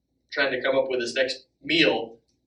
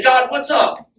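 casual complaint to God; 8 semitones higher, 6 dB louder, slower than usual. Pretend. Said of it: "we don't say".

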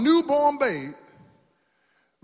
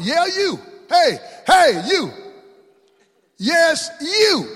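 higher, loud call of "you"; single out a listener with a loud high shout; emphatic call-out; 8 semitones higher, 7 dB louder, much slower than usual. Acted. Preacher calling out someone in the pews, loud and high. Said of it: "I put the emphasis on that".

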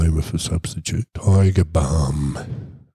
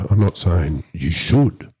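usual speaking voice

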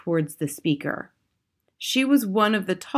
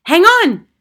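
high, loud urgent cry; Mildred; 12 semitones higher, 11 dB louder than usual. A narrator's reading. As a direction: cry out to halt everyone. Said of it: "cried the witch called Mildred".

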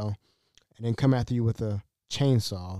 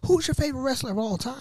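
high-pitched eager question; excited; 13 semitones higher than usual. Retold or imitated. Dad quizzing guest on wrestlers, excited. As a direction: ask it like a thrilled superfan. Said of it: "he got excited".

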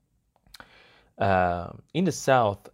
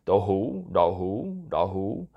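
southern Vietnamese accent. Shown as a demonstration. Host excusing an attempt at southern pronunciation, southern-accented "đậu hũ". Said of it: "excuse my southern accent here".

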